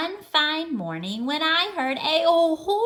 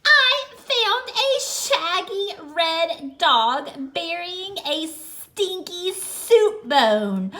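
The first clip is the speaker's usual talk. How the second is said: ordinary delivery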